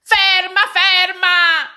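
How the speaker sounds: high, loud yell after a runaway; desperate shout. A narrator's reading. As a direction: call out frantically to stop him. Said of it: "gridò il signore". (urlo disperato, acuto e forte)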